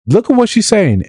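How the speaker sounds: high, indignant tattling voice; high-pitched mock outrage to her boss; mock tattling caller. Callers reporting her to employer.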